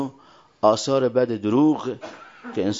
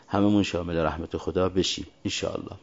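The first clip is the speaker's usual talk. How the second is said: usual speaking voice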